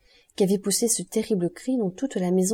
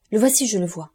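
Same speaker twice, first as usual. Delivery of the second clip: louder shout of discovery; cri sur le palier; 2 semitones higher, 5 dB louder than usual. A narrator's reading. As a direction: call out the sighting to the others. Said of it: "cria une voix sur le palier". (cri d'alerte lancé fort)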